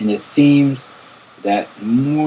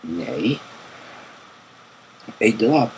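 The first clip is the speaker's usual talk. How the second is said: ordinary delivery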